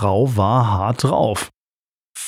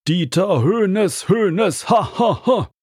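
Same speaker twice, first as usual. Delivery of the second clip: mocking; 9 semitones higher than usual. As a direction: jeer at the striker in a high sing-song. Fans mocking opposing striker.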